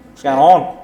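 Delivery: loud Aussie greeting, mate-style; Australian bloke calling out; hammed-up Aussie hello; Aussie accent. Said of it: "pretty good Aussie accent".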